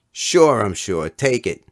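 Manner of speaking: high, loud, easygoing assurance; eager insistence. Acted. Fat kid insisting the boy take his chopper, eager assurance. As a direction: brush off the doubt.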